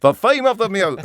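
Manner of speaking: loud, high, hammed-up announcement; mock proclamation. Mocking a character stating the theme too bluntly, hammed-up shout. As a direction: blare it out with no subtlety.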